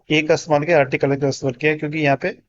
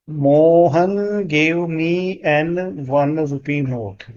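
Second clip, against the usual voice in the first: slow dictation; much slower than usual. Shown as a demonstration. Teacher dictating the next sentence slowly. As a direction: read it out for students to copy. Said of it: "आगे बढ़ते हैं".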